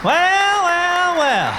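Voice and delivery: loud well well well. Acted. Cop entering a crowd.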